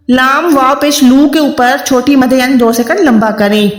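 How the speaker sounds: ordinary talk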